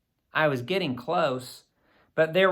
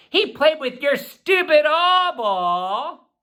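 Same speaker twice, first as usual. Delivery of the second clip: put-on higher, slower, scornful teen line; scornful sister; 10 semitones higher, 4 dB louder, slower than usual. A narrator's reading. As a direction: spit out the blame, drawn out.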